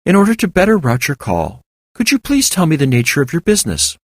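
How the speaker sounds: a machine that never tires of callers; chipper AI switchboard. A narrator's reading. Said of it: "The voice held all the chipperness".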